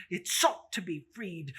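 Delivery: plain reading voice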